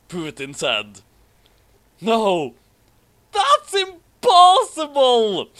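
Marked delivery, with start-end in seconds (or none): 2.0-5.5 very high, loud, slow hammed-up wail; anguished cry. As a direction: cry out in melodramatic despair. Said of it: "Suzaku cried emotion".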